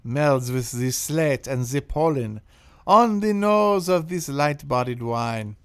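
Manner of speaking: loud, slow, hammed-up French accent; bad French accent. Host reads the wine review in a deliberately bad French accent. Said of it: "I will provide a bad French accent".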